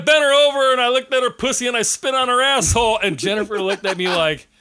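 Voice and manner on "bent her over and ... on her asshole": loud, high crude quote; quoting the radio guest. Retold or imitated. Dad retelling radio clip to friends, loud and high. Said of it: "it was something like".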